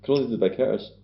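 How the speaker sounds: ordinary talk, nothing marked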